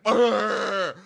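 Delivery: loud, high imitated roar; hammed-up monster growl; mock angry roar. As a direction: roar as if about to lash out. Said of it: "to hit everybody there".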